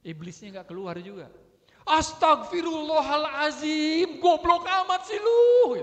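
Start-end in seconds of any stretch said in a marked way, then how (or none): 1.9-5.8 slow, high furious yell; hammed-up raging prayer turning into an insult; istighfar iblis. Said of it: "ini istighfarnya istighfar iblis kayaknya". (teriakan marah melengking, diulur lambat)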